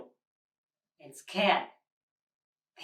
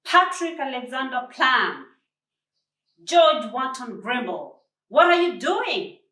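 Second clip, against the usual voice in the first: louder, higher stern telling-off; stern scolding; 5 semitones higher, 9 dB louder than usual. A narrator's reading. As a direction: call the grown men out like naughty boys. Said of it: "the stern voice of Josephine".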